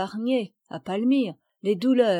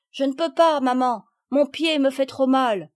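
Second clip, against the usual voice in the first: high, loud complaint; pained child calling; 5 semitones higher, 6 dB louder than usual. A narrator's reading. Palmyre refuses to come to mother, pained high call. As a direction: call back plaintively from another room. Palmyre calls from next room, hurting.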